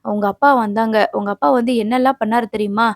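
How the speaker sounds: ordinary delivery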